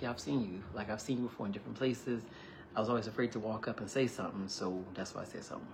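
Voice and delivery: a nervous man confessing to her over lunch; soft and vulnerable. Retold or imitated. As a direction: confess it shyly, barely above a hush. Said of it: "He was very vulnerable".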